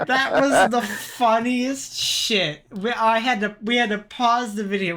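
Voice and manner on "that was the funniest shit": high voice through laughter; laughing hard. Real, not acted. Telling friends about the funniest video, laughing hard. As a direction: talk through a real laughing fit. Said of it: "We were laughing so hard".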